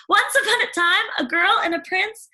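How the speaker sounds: put-on high, bright chatterbox girl; gushing young girl, rising as she goes; eager Cindy telling about animals. Cindy voiced in story.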